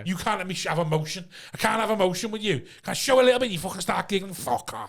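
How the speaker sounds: mild mock outburst at his girlfriend; retold rant. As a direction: complain at her with building frustration. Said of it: "I'm like".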